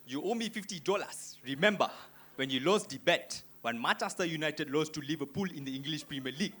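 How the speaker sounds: put-on African dude accent, punchy and indignant; comic African-accented argument. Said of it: "I saw two African dudes".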